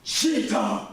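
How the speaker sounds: high, repeated accusing call; teacher calling out. Retold or imitated. Teacher at the front of the class, high accusing call. And she goes.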